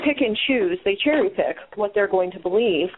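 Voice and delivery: ordinary delivery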